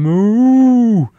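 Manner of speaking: loud, high mock moo; cow moo. Cow standing over him in the field, loud high mock moo. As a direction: bellow out a cow's moo. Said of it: "a cow standing over me going".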